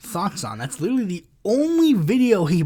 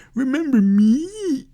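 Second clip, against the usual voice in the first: slow, higher mock voice saying remember me; mocking impression; 8 semitones higher, 5 dB louder, slower than usual. Mocking a rival's video, slow and higher. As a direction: drag it out like a cheesy video line. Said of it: "stupid video of you saying".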